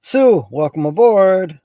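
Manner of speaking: welcoming a new viewer loudly; cheery loud welcome. Real, not acted. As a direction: call out a big welcome to the newcomer.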